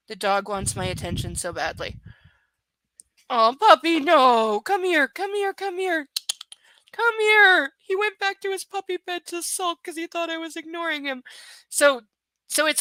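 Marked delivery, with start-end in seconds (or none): 3.3-9.4 high, eager call to the dog; coaxing puppy call. Real, not acted. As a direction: coax the puppy over. Calling the dog over.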